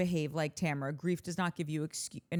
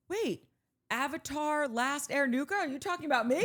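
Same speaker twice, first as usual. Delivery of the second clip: higher, playful fake gasp of recognition; mock surprise; 8 semitones higher than usual. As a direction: play the commenter who just spotted their own name. Imagined commenter finding themselves.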